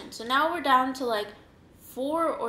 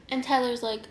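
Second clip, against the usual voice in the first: ordinary talk, nothing marked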